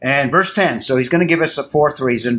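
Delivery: ordinary delivery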